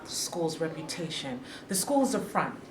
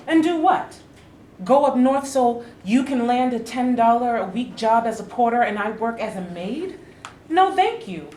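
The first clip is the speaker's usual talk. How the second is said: raised, high, insistent refusal; heated protest; 3 semitones higher, 5 dB louder than usual. Acted. Wife refusing husband's plan to go north, raised and insistent. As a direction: shoot down his plan loudly.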